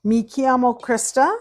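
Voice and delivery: bright Italian intro; Italian phrase. Shown as a demonstration. Introducing herself to viewers in Italian, upbeat. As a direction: proudly say your name in Italian. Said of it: "Since my name is Krista".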